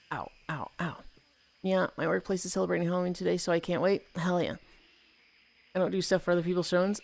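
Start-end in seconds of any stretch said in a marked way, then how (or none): 0.1-1.0 very soft little ows; small real yelps of discomfort, hushed; pained from stretching. Real, not acted. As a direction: wince quietly through the stretch. Hurting while doing the splits.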